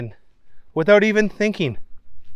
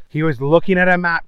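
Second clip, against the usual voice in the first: plain reading voice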